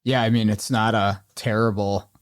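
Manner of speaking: ordinary talk, nothing marked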